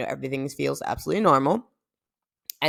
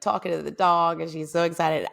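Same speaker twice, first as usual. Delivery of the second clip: ordinary talk, nothing marked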